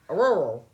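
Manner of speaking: "A rural" loud and high, as if mouth full; burger-bite rural. Shown as a demonstration. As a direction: slur "A rural" like "I love you" through burger. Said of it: "like you took a bite of a burger".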